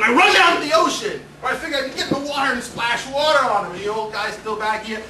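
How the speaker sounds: ordinary talk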